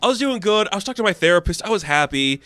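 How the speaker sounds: put-on high, fed-up teenage grumble; lightly voiced sulky girl; whiny complaint. Nadia voiced, complaining to Janet.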